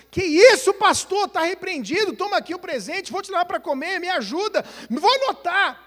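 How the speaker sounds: high, loud eager apology; eager church members. Retold or imitated. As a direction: rush to make amends. (fiéis ansiosos se desculpando com o pastor, agudo e alto)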